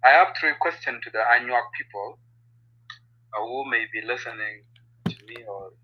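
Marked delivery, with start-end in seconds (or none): 3.3-5.7 faint, dropping almost to nothing; barely audible. Real, not acted. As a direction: voice fading off the mic. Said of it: "'cause your voice is very low".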